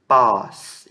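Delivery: "Pós" loud and low with an open O; open vowel demo. Shown as a demonstration. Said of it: "Esse tem som aberto".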